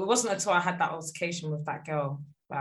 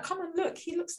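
high, softer voice of mum calling dad over; admiring mum; 11 semitones higher, 5 dB quieter than usual. Retold or imitated. Daughter mimicking mum calling dad to the casket, admiring. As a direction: imitate mum urging him to look. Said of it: "telling my dad, like".

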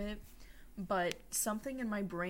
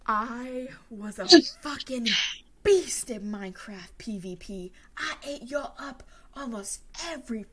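loud triumphant gloating; excited boast; 5 semitones higher, 10 dB louder than usual. Real, not acted. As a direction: brag it loud like a champion. Bragging about past Minecraft skill.

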